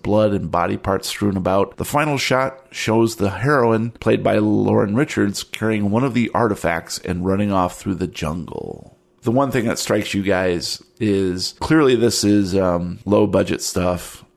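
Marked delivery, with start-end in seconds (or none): none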